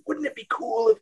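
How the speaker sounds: put-on high-pitched eager twelve-year-old; excited kid voice. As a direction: gush like a kid with an idea. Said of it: "a twelve-year-old going".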